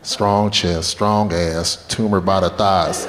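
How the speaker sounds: low, measured tallying of clues; deadpan realization. Retold or imitated. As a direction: drop low and list it drily. Slowly summing up clues.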